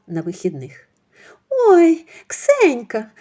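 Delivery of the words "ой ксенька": high, loud startled cry; flustered surprise. Acted. Mother-in-law caught out by Ksenia, flustered and startled. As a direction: caught out and fussing. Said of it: "заметушилася свекруха".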